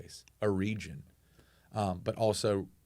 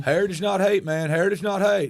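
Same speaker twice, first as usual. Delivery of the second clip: mocking good old boy; 9 semitones higher, 6 dB louder than usual. Mocking the good old boy in his truck, loud and high. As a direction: parrot the bumper sticker with scorn. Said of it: "that good old boy in Reidsville".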